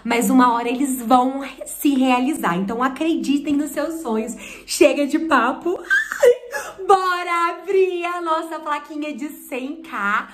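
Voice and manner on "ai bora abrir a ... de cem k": high-pitched giddy burst, easing down; excited squeal. Real, not acted. Creator about to open the plaque for viewers, excited squeal. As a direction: squeal with excitement as you launch in.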